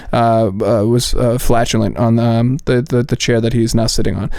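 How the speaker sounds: translate it dryly, pausing to find words; deadpan interpreter. Acted. Interpreter relaying Usyk's answer about the fart, deadpan. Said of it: "going to an interpreter and be like".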